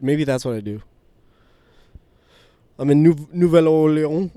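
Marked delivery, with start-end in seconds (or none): 2.8-4.3 hammed-up French, louder; French tourist voice; fake French accent. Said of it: "I could just say that".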